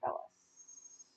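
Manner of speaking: ordinary delivery, nothing marked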